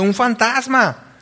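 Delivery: touch of fear, pitch lifted; frightened cry. A narrator's reading. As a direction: read it as a startled outcry, lightly acted. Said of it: "dieron voces de miedo".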